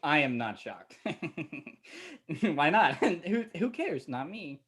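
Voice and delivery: low, giddy giggles between the words; delirious chuckling, coming unhinged; hysterical laughing. Acted. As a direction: laugh helplessly as you stop caring. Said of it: "I think you're hysterical".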